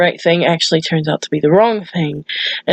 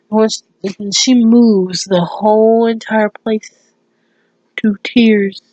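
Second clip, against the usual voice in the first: ordinary talk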